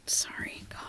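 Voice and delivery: whispered aside, no voice at all. Real, not acted. Streamer whispers an apology to chat after swearing, unvoiced whisper.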